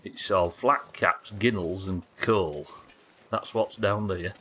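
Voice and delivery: put-on slow, broad South Yorkshire Rotherham accent; a gruff Rotherham local grumbling about posh folk, slow; thick Rotherham Yorkshire accent, slow. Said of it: "Right, Rotherham one".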